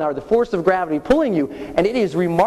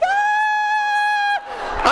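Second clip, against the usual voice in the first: long, very high comic shriek; sustained falsetto scream; high-pitched scream. Retold or imitated. Skydiver falling at high speed, screaming high-pitched. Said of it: "I'm so high pitched".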